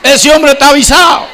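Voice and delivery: "Ese hombre está avisado" shouted high and loud; preaching shout. Acted. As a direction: cry it out to the congregation. Preacher repeats the line, shouting.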